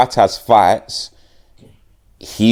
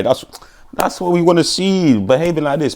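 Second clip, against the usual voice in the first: much higher singsong praise; pet praise voice; 9 semitones higher than usual. Real, not acted. Praising the dog for drinking water, high cooing praise. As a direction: coo at the dog.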